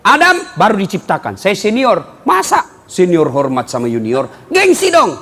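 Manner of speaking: a hint of a smug, bragging devil; arrogant Iblis. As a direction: boast like a haughty senior. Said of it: "Kenapa kau tidak mau?".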